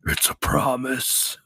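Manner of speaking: slow, drawn-out promise; acting voice. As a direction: say it slowly as a put-on threat. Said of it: "your acting voice".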